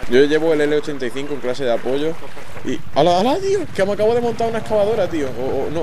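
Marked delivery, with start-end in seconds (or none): 2.9-5.3 excited. Real, not acted. Amazed at riding an excavator.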